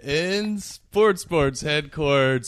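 loud, slow host welcome; announcer voice. Acted. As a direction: open the show like an announcer. Hosting show intro.

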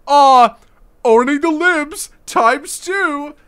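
high, slow sneering imitation; mocking whiny voice. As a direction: parody the tweeter with a sneer. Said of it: "this fucker's like".

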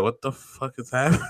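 retold bafflement breaking into laughter; laughing disbelief. Retelling the cashier encounter to a friend, laughing in disbelief. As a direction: crack up softly while quoting yourself.

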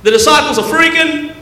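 high, excited sermon storytelling; animated preaching. Acted. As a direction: preach the tale with high energy, acting out the squabble. Animated preached retelling of the storm.

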